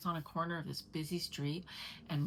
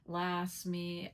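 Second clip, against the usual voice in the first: ordinary talk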